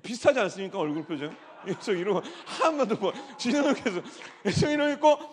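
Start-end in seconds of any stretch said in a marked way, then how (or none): 1.7-5.2 laughing it out as the voice climbs. Real, not acted. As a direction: giggle through the description. Laughing while describing members.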